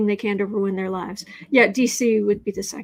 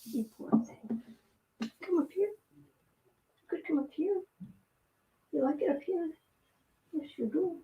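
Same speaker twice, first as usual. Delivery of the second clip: soft, higher cooing to a cat; pet talk; 5 semitones higher, 11 dB quieter than usual. Real, not acted. Reader coaxing her cat Alfie onto the desk, soft cooing.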